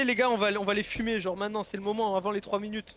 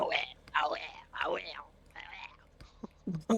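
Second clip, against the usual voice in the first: whispering; 5 semitones lower, 8 dB quieter, much slower than usual. Real, not acted. Mock-awed reaction.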